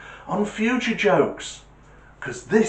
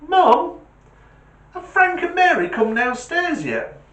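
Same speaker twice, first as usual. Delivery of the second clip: put-on kid calling mum; 6 dB louder than usual.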